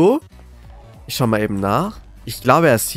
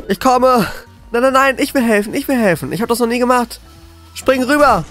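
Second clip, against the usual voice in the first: high, frantic gamer yelling; panicked excitement; 8 semitones higher than usual. Real, not acted. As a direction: rush in a panic to help. Panicked rescue attempt in game.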